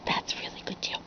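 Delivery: whispered aside. Real, not acted. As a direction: whisper it like a secret. Aside to listener.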